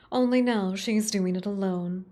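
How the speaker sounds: ordinary talk, nothing marked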